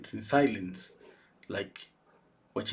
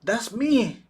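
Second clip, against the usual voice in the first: proud claim; 9 semitones higher, 4 dB louder, much slower than usual. Retold or imitated. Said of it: "And she was".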